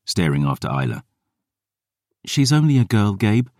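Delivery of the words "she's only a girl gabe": higher, gentle appeal; a hint of Dior; pleading girl. Dior's voice.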